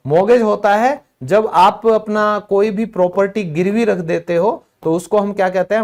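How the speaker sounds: ordinary talk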